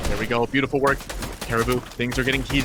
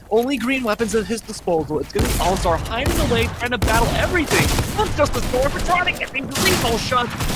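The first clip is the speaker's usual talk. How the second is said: high, rising esports play-by-play; esports caster; 7 semitones higher than usual. Real, not acted. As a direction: call the end-game fight live, building tension. Caster calling live end-game action.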